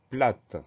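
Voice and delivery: "plate" said alone with a sounded T; T pronounced. Shown as a demonstration. Teacher recalling the feminine form, final T pronounced in "plate". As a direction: make the final T of "plate" heard. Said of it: "au féminin de ce mot".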